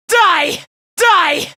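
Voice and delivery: high, fierce attack yell; vicious battle shout. Acted. Fighter striking to kill, fierce high shout. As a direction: hurl it at the enemy.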